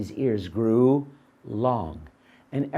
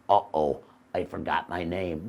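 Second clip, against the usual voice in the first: dopey donkey; 9 semitones lower, 5 dB quieter than usual. Acted. Donkey voiced low and soft.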